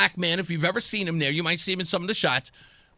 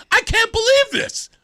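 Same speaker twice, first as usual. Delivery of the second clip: very high, slow, loud exclamation; retold thrilled incredulity; amazed disbelief; 5 dB louder than usual. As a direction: marvel at it in a high-pitched voice. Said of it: "And I'm going".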